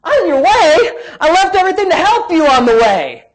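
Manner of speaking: high, loud, offended protest; hammed-up aggrieved outburst; indignant Peter. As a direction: throw it back in outraged disbelief. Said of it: "Here's Peter saying".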